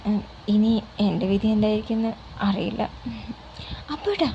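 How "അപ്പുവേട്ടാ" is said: higher, reproachful call of his name; a mild scolding as she calls out അപ്പുവേട്ടാ. Acted. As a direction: chide him gently by name. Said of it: "അവൾ ശാസിനിയോട് വിളിച്ചു".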